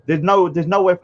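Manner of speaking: ordinary delivery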